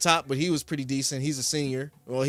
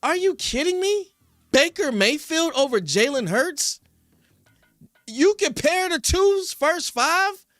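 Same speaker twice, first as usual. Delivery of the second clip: high-pitched, incredulous rant; outraged disbelief; 11 semitones higher than usual. Real, not acted. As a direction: blow up at the absurd take, pitch way up. Outraged at TikTok guy's ranking.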